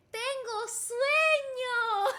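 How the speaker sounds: slow, high, Dora-like teacher voice; Dora-style teaching. Shown as a demonstration. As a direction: spell it out slowly like Dora. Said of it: "I feel like Dora".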